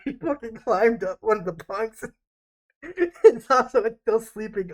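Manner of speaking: laughing. Real, not acted. Picturing the sergeant climbing bunks, laughing. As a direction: crack up as you tell it.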